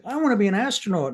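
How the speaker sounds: high, fast and bright with hope; childlike excitement. Retold or imitated. Retelling her childhood dream, eager and loud. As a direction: blurt out a kid's big dream. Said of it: "And I was like".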